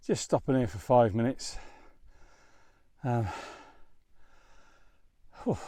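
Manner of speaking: out of breath after a climb. Real, not acted. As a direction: talk between breaths, winded. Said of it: "bit out of breath actually".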